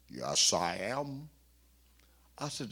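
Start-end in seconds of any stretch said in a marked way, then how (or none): 0.1-1.3 slow, lightly voiced elderly preacher; a hint of a stately old man. Gardner Taylor answering the young pastor, lightly voiced.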